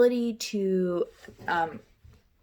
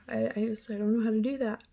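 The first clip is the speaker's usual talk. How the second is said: ordinary delivery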